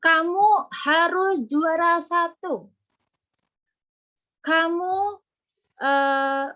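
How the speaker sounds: pushy parent demanding top grades. Shown as a demonstration. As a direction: pile pressure on the child. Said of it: "Contohnya gini".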